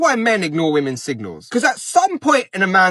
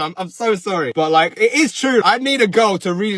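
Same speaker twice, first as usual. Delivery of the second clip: ordinary talk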